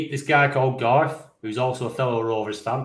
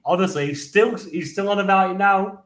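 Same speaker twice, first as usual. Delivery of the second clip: ordinary talk, nothing marked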